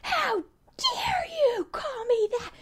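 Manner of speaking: high, shrill-edged indignant outburst; mock outraged cry. Teen daughter snapping back at her mom, mock outrage. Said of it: "just so angry".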